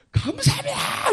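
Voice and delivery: high, loud tearful cries of thanks; shouting through tears. Retold or imitated. Big soldier weeping, shouting thanks to God. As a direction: cry out thanks at the top of the voice. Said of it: "팔백 명 소리 다 잡아먹는 거예요".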